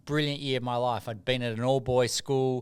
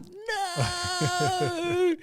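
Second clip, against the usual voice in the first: high, stretched "No"; mock refusal; 3 dB louder than usual. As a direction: refuse in disbelief, hammed up. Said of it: "And I'm like".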